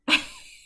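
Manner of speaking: short high, loud laugh; burst of laughter. Real, not acted. Cracking up over grandma's muddled soup order, a high laugh. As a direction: crack up mid-story.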